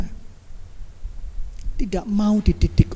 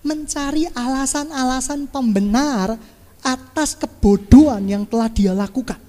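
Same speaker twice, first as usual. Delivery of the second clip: usual speaking voice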